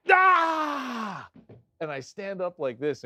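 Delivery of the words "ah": loud, high acted scream of pain; high yell, re-enacting pain; retold scream. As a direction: scream out as if fingers just dislocated. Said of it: "and I'm screaming".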